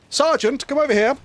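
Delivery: high, loud call across the camp; British general summoning his sergeant; called order. Acted. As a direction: shout for the sergeant like an officer. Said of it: "he sort of looks around and he goes".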